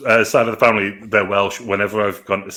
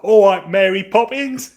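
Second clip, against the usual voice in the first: high, slow, drawn-out mock English accent; 10 semitones higher, 4 dB louder, slower than usual. Imagining Kevin Costner coming out, mock English accent. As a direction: send up a bad English accent.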